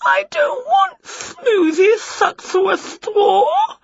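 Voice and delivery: high, drawn-out wail. Acted. Witch refusing the straw, high wail. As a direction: wail it like a child. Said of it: "wailed Winnie".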